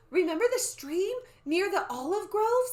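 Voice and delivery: high, loud eager question; bright-pitched child character voice; young Annie's voice. Acted. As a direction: ask it as an excited girl. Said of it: "I have to use a different voice".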